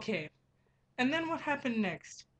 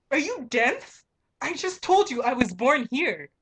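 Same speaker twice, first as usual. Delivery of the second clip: louder, higher, snapping back in disbelief; indignant retort; 4 semitones higher, 7 dB louder than usual. Retold or imitated. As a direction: throw it back at him, offended. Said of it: "He's like".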